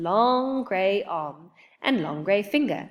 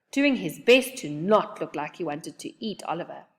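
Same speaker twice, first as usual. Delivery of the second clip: ordinary delivery, nothing marked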